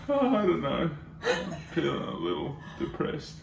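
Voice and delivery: soft, mopey fake sadness; mock depressed. Husband retelling his fake-gloomy reply to wife, soft and mopey. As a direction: sigh it out like a sulky child. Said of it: "And I said".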